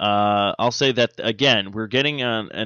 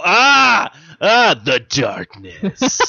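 slow, drawn-out mock spooky sigh; a hint of a hammed-up ghost; spooky drawl; 8 semitones higher, 8 dB louder, much slower than usual. Mock demon moaning its own name, slow. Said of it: "we all sound like we're from Boston".

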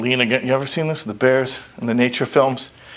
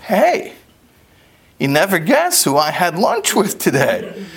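high, thrilled squeaky fish voice; a fish bragging it's the king's guest, gushing; giddy, much higher voice, pleased with himself; excited fish; 9 semitones higher than usual. Acted. Lox excited he's the king's guest.

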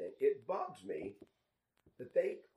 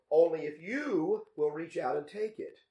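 "you" punched higher and louder; stress on you; 4 semitones higher, 7 dB louder than usual. Shown as a demonstration. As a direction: lean hard on "you". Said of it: "emphasizing the word you".

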